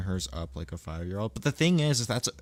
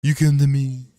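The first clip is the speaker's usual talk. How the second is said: loud, raised put-on mob boss voice; Italian Trump; 6 semitones higher, 10 dB louder, faster than usual. Streamer attempting an Italian Trump, loud hammed-up impression. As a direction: belt it out as a hammed-up Italian don. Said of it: "Italian Trump".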